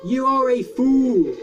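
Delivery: loud, slow sneering taunt; villain taunt. Acted. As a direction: gloat like a panto villain.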